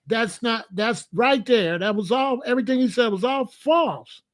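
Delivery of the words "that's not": ordinary delivery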